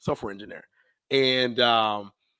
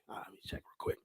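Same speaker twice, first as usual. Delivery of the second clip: hushed retold whisper, no voice at all; 17 dB quieter, faster than usual. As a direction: breathe it out like checking a phone in secret. Retold checking spam folder quietly.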